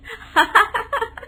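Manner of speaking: a laugh she can't hold back. Acted. Laugh bursts out at mourning. (ज़ोर से फूटी हंसी)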